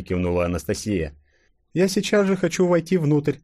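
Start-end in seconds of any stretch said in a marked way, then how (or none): 1.7-3.4 high, lightly voiced girl; young princess. A narrator's reading. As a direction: greet him with relief. Tsarina insists. (Анастасия радостно отвечает Колчаку)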